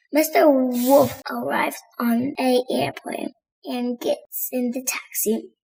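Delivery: plain reading voice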